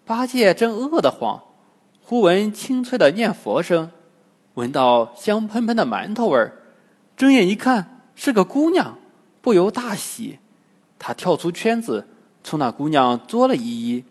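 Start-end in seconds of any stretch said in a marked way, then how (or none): none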